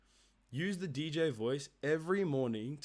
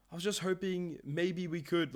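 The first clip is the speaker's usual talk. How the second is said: higher, hesitant needy ask; uncertain, needy; 5 semitones higher than usual. Shown as a demonstration. Sales coach shows a desperate seller's uncertain ask. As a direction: sound like you're begging for a minute. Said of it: "it's so uncertain".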